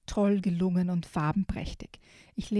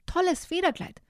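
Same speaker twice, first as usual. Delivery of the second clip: high, bright-voiced calling out to a friend; cheery chameleon greeting; 10 semitones higher than usual. Chameleon greeting the parrot Polly, high cheery call. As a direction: call up to the parrot with a big hello.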